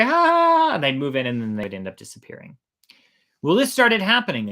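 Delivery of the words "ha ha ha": high, loud carefree fake laugh; hammed-up careless laughing; mock laughter. Villagers laugh off shark warning.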